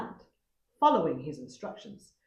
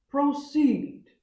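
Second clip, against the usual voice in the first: higher, louder command; proud leopard commanding, raised; 9 semitones higher, 8 dB louder than usual. A narrator's reading. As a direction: order the servants to go ahead. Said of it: "he commanded".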